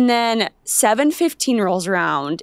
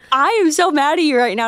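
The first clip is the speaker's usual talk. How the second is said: higher, pouty scolding with stress on so; playful scolding of her boyfriend; mock angry; 6 semitones higher, faster than usual. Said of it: "I was like".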